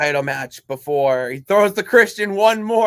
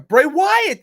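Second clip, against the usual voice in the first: excited; 10 semitones higher, 7 dB louder than usual. Real, not acted. Excited pitch of wrestler name.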